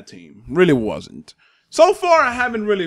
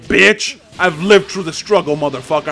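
louder, mocking taunt at the opponent; loud trash talk; 3 semitones higher, 5 dB louder than usual. Real, not acted. Gamer taunting opponent who tried to counter, loud trash talk. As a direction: rub the counter in his face.

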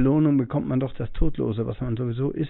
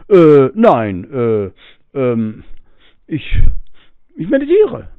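flustered student; 3 semitones higher, 11 dB louder, much slower than usual. Retold or imitated. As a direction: stumble through the excuse like a caught pupil. Student stammers answer to teacher.

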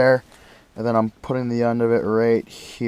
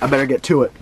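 ordinary delivery

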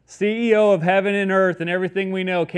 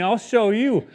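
higher-pitched playful menace; mock scary threat; 6 semitones higher than usual. Preacher imagining coming off the cross at mockers, mock menacing. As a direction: taunt them like a Halloween ghost. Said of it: "like a scary ghost on Halloween".

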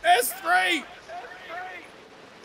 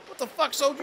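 usual speaking voice

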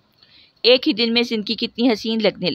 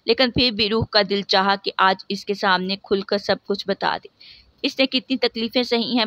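ordinary delivery

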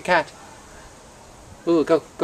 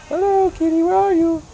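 sing-song squeal to the pup; 4 dB louder than usual. Real, not acted. High baby talk to cat.

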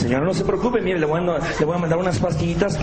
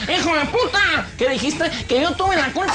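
high-pitched comic outrage; indignant, high-pitched confrontation; angry driver; 9 semitones higher than usual. Acted. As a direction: play an offended driver picking a fight. Acted quarrel after minibus cuts in.

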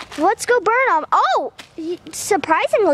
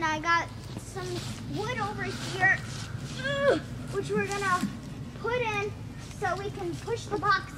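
hushed narration; 13 dB quieter than usual. Real, not acted. Vlogger narrating quietly while burning the box, softer. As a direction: talk softly over the fire.

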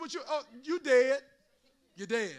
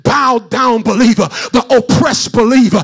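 chanted, whooping sermon cadence; shout-sing the sermon over the music; preaching at full force; 2 semitones higher, 27 dB louder than usual. Acted. Preacher whooping to the congregation, full force.

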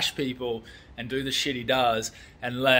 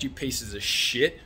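normal talking voice

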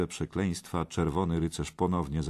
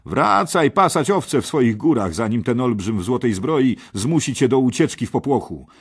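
high, loud taunt; jeering scorn; 6 semitones higher, 8 dB louder than usual. Acted. Kej taunts the shepherd boy, loud and scornful. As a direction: send the upstart off with contempt. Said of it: "szydził pan Kej".

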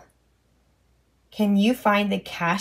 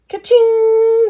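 much higher, louder cash register ring; bright ka-ching; 11 semitones higher, 6 dB louder than usual. Pretend. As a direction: ring it out high like a till. Cash register sound voiced.

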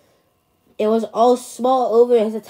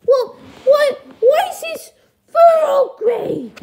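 very high falsetto, demanding answers; high-pitched puppet parent, aghast; duckling's parent, baffled; 7 dB louder than usual. Acted. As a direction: squeal the question in disbelief.

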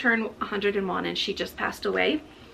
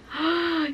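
gasp; 8 semitones higher than usual. Real, not acted. Card reveals gift for [name].

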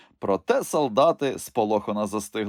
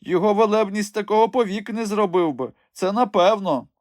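put-on high, thin old woman voice; стара жінка; 11 semitones higher, 3 dB louder than usual. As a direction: play the old widow begging sweetly. Robin disguised as old woman. (висока тонка старечий голос)

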